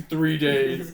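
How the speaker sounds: loud mocking echo. Real, not acted. Echoing the joke loudly.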